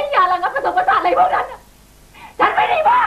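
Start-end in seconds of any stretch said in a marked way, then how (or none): 2.4-3.0 higher, shouted denial; furious cry. Acted. Wife refusing to be called crazy, shouting in anger. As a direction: shout it out, cornered and hurt. Wife denies being mad.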